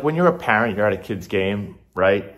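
ordinary delivery

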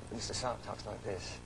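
softly spoken upper-class British accent; posh British accent, understated. Shown as a demonstration. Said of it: "Give me a little of the accent".

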